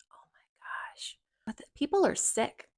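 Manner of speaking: real whisper of dismay; shocked whisper. Real, not acted. Vlogger reacting to a disturbing video, whispering in shock. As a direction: breathe it out in disbelief, barely voiced.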